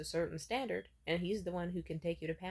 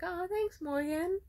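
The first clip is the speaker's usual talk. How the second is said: much higher, saccharine fake-sweet thank you; mock-sweet thanks; 12 semitones higher, 5 dB louder, slower than usual. Thanking ex Morgan for the laptop, sugary mock-sweet voice. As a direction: coo it to butter him up.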